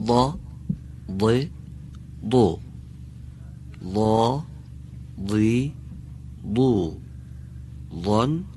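each «ضا، ضی، ضو» clearly heavy and backed; emphatic dad drill. Shown as a demonstration. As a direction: drill the syllables with a thick dad. (تمرین هجاهای ضاد درشت)

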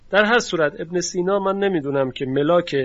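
ordinary delivery

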